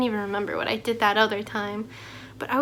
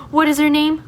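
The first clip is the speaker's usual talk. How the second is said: loud, higher mock teacher drill; imitating a teacher; 4 semitones higher, 8 dB louder than usual. Teacher quizzing a class on the new student, loud mock drill.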